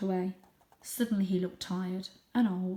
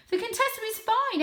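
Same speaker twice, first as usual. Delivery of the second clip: high, brushing off worry; dismissive aunt; 5 dB louder than usual. Acted. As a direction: wave the fear away. Junie insists.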